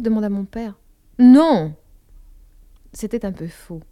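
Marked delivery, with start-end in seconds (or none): 1.2-1.8 loud, abrupt no; sharp denial. Acted. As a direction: bark out the denial. Denies missing the boy.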